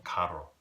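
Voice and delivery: "carro" with a tongue-tip trilled rr; rolled Spanish R. Shown as a demonstration. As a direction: roll the rr in "carro". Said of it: "it's a much softer".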